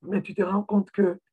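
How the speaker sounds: normal talking voice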